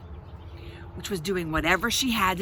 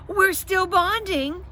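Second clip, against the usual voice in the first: loud, high, drawn-out bellow; 11 semitones higher, 5 dB louder, slower than usual. A narrator's reading. Babysitter bellows at parents knocking at the door, loud. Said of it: "Selinski bellowed".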